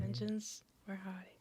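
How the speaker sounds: breathy dramatic whisper into the mic; giggly prank whisper. Acted. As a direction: whisper it like a spooky secret. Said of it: "Jay loves it when we whisper into the mic".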